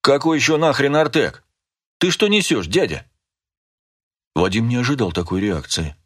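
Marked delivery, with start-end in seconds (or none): 0.0-3.1 higher-pitched, indignant challenge; indignant scoffing. A narrator's reading. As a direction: scoff at a stranger's nonsense. Armed man scoffs at the password. (возмущённо, с наездом)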